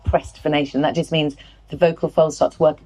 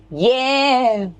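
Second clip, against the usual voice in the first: high twangy held call; twangy vocal warm-up; twang; 8 semitones higher than usual. Shown as a demonstration. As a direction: twang it out, pitched up. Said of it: "we're gonna twang and go".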